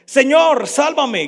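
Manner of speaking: loud, high desperate plea; horrified cry. A narrator's reading. Peter sinking in the waves, horrified loud cry. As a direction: cry out as you start to sink. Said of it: "gritó horrorizado".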